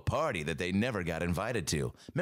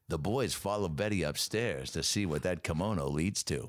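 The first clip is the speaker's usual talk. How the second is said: ordinary talk, nothing marked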